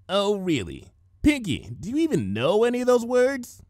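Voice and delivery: skeptical, needling a friend; Rainbow Dash voice. A narrator's reading. Rainbow Dash teasing Pinky about her big words, lightly voiced.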